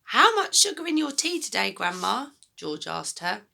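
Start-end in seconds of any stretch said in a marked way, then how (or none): 0.0-2.3 put-on the boy's line, corrected, lighter and less loud; a polite small boy asking his grandma; George's voice. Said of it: "oh, sorry, that's George".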